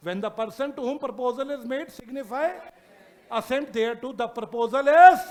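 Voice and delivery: drawn-out word-by-word reading; slow dictation. Shown as a demonstration. Teacher dictating the acceptance definition, very slow. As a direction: read it slowly so they can write. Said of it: "dictating definition to class".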